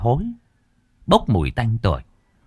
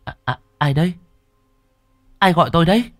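frightened; 8 semitones higher, faster than usual. Acted. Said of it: "Thúy đáp".

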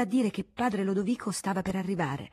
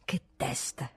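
unvoiced, lower whisper to himself; whispered muttering; 6 semitones lower, 7 dB quieter, slower than usual. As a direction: breathe it to yourself, no voice. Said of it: "continuando a parlar solo". (sussurrato tra sé)

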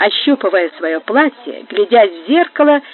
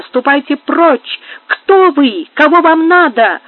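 alarmed cry, higher and louder; 5 semitones higher, 5 dB louder, slower than usual. A narrator's reading. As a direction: demand it in a raised, frightened voice. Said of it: "вскричала она".

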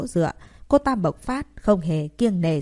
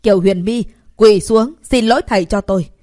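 yelling demand; 3 semitones higher, 7 dB louder than usual. Acted. Students yelling at her.

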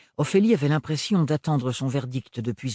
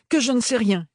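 blurted out; 10 semitones higher, 4 dB louder than usual. Acted. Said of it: "avait presque jailli des poumons".

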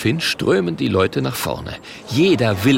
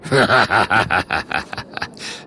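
mocking villain laugh. The Murk scoffing at Oskar, mocking belly laugh. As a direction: laugh it out in a long staccato run. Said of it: "lacht der Murk".

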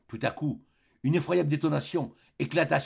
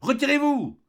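high, loud command to disperse; shouted order; 12 semitones higher, 5 dB louder than usual. A narrator's reading. Police commissioner to the insurgents, loud high order. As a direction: bark the warning down the street. Police issuing legal warning.

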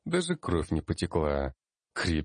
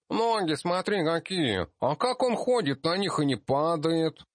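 put-on high wondering kid voice; a little dwarf kid gawking; curious child gnome; 12 semitones higher than usual. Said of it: "спрашивал один". (детский удивлённый голос)

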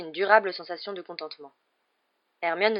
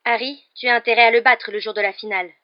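loud, raised, insistent order; sharp and forceful, pitched up; piercing voice; 5 semitones higher, 12 dB louder than usual. A narrator's reading. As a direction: call it out piercingly. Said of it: "lança Hermione d'une voix perçante".

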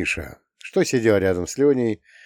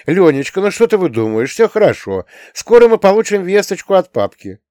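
raised, louder reassurance; insistent soothing, higher and animated; hurried comforting; 9 semitones higher, 6 dB louder than usual. A narrator's reading. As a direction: talk an upset child round. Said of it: "принялись успокаивать его".

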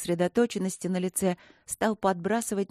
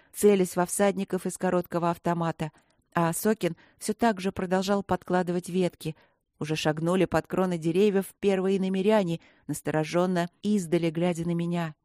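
plain reading voice

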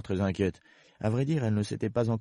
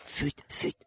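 whispered, anxious hushing; quick urgent murmur; 7 dB quieter than usual. Acted. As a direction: hush her in a whisper. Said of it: "murmura vivement le lapin".

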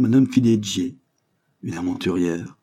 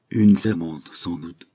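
ordinary delivery

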